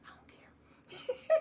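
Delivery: breathy high whisper, slow; playful whisper. Real, not acted. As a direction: toss it off in a teasing whisper. Playful aside while reading.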